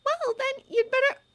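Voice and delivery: put-on high, loud girl's voice; Hermione voice. Hermione voice again.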